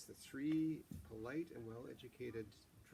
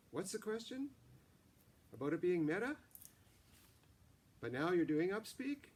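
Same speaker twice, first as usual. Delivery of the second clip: high rising questions, louder; upspeak; 7 semitones higher, 7 dB louder than usual. A narrator's reading. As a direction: keep lifting every phrase upward. Mara argues in upspeak.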